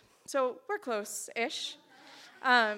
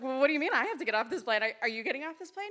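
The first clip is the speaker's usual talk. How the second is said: retold bafflement; 3 semitones higher, 4 dB louder, faster than usual. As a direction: throw it back in mild mock disbelief. Retold baffled challenge to seatmate.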